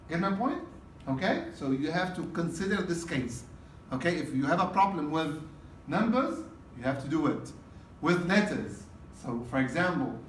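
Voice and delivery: ordinary talk, nothing marked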